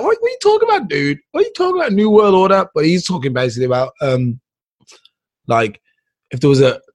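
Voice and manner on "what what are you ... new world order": very high, squeaky mock bewilderment; hammed-up incredulous outburst; baffled disbelief. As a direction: question it in exaggerated disbelief. Acted baffled reaction to the speech.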